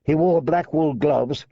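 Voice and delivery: usual speaking voice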